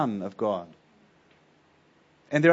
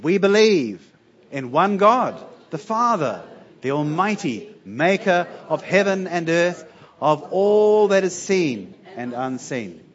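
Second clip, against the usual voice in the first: creed recitation; 2 semitones higher, 4 dB louder than usual. Acted. Congregation reads creed aloud.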